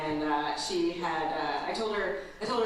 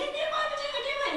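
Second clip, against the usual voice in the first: put-on much higher whiny child voice; little daughter; faster than usual. Mom imitating her daughter, much higher child voice. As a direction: play the panicked little girl. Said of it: "And she's like".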